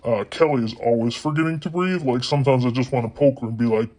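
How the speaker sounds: ordinary talk, nothing marked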